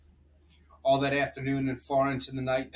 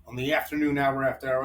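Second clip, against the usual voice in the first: plain reading voice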